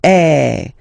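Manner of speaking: loud open eh, mouth wide; open French è. Shown as a demonstration. Teacher demonstrating the open è sound. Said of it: "the sound that you will have to produce is".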